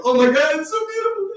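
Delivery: very high, squealing delight; gushing family. Retold or imitated. Family reacting to the vet, high squealing gush. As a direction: swoon over the ashes.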